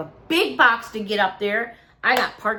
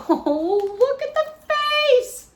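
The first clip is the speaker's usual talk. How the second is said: very high, slow squeal of delight; 3 dB louder, much slower than usual. Real, not acted. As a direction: coo at the face, really high. Delighted at toy face.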